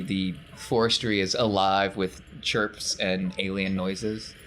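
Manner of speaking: ordinary talk, nothing marked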